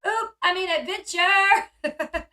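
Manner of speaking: high, loud comic backtrack with a drawn-out ending; slow, stretched mock correction; joking yell. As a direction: imitate a playful slip, hastily covered up loudly. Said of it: "[name] jokingly yells".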